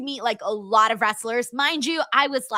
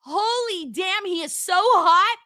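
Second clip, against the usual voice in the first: starstruck gush; 7 semitones higher, slower than usual. Retold or imitated. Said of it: "I was like".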